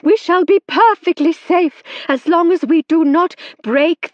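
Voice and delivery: high, loud urgent reassurance; calm the panicking table loudly. A narrator's reading. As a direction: medium reassuring the circle over noise. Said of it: "shouted Madame Eugenie".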